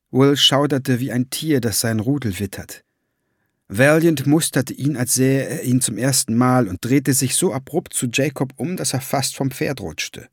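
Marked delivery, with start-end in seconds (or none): none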